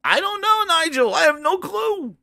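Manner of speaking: high-pitched exaggerated cluelessness; helpless bafflement. Answering Nigel about the show, high baffled helplessness. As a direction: pitch it high, all at a loss.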